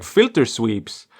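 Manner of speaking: ordinary delivery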